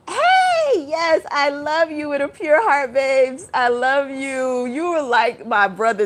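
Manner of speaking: high, loud shout-out; excited shout-out. Real, not acted. Shouting out a friend in the chat, high and loud. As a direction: call out across the room to a dear friend. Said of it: "I'm shouting out Aries people".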